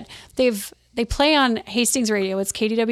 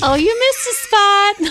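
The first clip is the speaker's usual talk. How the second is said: high, slow, loud mock-sweet approval; hammed-up flirty commercial voice; razor ad woman; 11 semitones higher, 6 dB louder, slower than usual. Shown as a demonstration. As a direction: purr it like a woman checking his shave. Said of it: "the voice of the woman that inspects the man's shaving".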